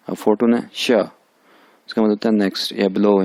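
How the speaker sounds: ordinary talk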